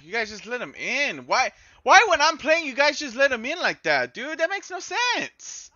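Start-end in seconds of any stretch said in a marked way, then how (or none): 1.3-5.7 exasperated rant. Real, not acted. Complains about conceding.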